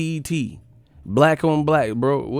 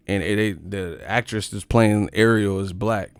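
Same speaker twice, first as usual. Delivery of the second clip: ordinary delivery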